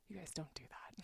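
a secret warning, breathed with no voice; whispered aside. Real, not acted.

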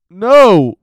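loud hammed-up no; mock flat refusal. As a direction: bark a joking refusal. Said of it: "he was like".

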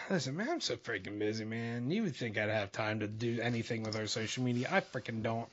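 very soft, low-pitched aside; quiet grumbling aside. Real, not acted. Host confessing he is too busy for social media, quiet grumble. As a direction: grumble it low, nearly under your breath.

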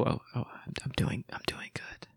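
hushed whisper so the dog stays calm; whispering on the phone. Real, not acted. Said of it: "I have to whisper".

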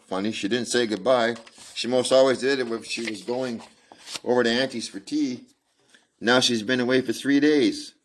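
put-on a small girl wondering where her mother went; little girl voice.

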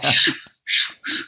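imitated whoosh of running; sharp voiceless shushes, one after another; rushing noise. Freaked-out traveler running to the gate, imitated whooshing.

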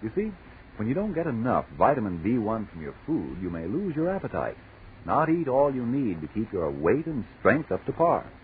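ordinary talk

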